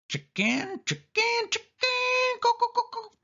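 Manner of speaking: very high, squawking chicken chant; chicken clucking taunt. A narrator's reading. Sari mocks her scared cousin, clucking like a hen. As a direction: cluck it like a hen to mock him. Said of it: "clucking like a chicken".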